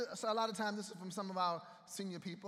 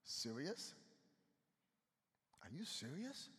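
incredulous Jesus; 5 semitones lower, 12 dB quieter than usual. Acted. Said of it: "Jesus is like".

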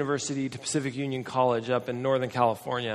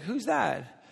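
higher-pitched wife's question; imitated wife; 7 semitones higher, 4 dB quieter than usual. Wife asking husband about the visitor, imitated high voice. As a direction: lift the pitch as the curious wife. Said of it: "my wife's like".

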